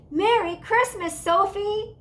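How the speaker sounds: high, loud happy bellow; little brother greeting his sister, bellowing. A narrator's reading. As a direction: shout it with joy. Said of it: "he bellowed".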